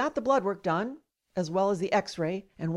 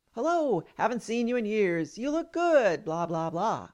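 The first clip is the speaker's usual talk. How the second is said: mock friendly greeting. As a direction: pretend pleasantries, pitched up at first. Mock friendly greeting he never gave.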